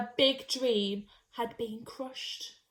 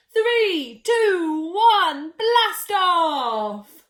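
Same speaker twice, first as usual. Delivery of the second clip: excited countdown; 4 semitones higher, 8 dB louder, much slower than usual. Acted. Countdown to rocket launch.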